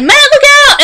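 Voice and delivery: high, loud cry of warning; shouted warning. Retold or imitated. Elena yelling to Matt to look out, high alarmed shout. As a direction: yell the warning at the driver.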